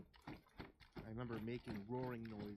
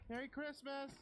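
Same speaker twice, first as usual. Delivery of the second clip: put-on high distant children's holler; kids hollering; 3 dB louder than usual. Neighbor kids hollering greetings back to the girl. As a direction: call back down the street. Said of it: "they hollered one after another".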